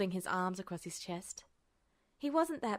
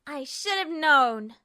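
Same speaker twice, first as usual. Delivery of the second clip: higher, louder, slow furious snarl; vehement snarl; 7 semitones higher, 11 dB louder, slower than usual. A narrator's reading. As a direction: spit it out in rage. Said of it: "she snarled vehemently".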